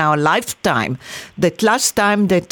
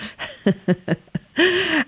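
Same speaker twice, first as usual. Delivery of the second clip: high, soft laugh; real laughter at a joke; amused laugh; 9 semitones higher, 4 dB quieter than usual. Real, not acted. Host reacts to guest's adoption joke, amused laugh.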